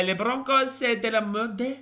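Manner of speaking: mock French accent. Reading a viewer's French dig at the Broncos in a high mock French accent. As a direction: ham up a French insult, pitched high. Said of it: "That's how you say Broncos are shit in f-, French".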